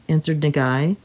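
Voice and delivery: ordinary delivery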